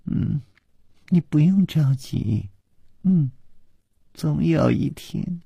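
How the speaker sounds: soft, slow, coy promise; slowly, bashfully. A narrator's reading. Blushing girl promising him one day, slowly. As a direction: draw it out shyly, with little hums. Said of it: "缓缓地说".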